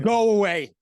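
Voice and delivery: loud, high-pitched dismissal; mock exasperation. Cops giving up on the Mando skateboarder, mock exasperation. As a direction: throw up your voice as if fed up.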